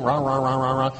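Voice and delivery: low mock grumble; imitated grumbling. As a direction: drone like a chronic complainer. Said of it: "That's what it sounds like".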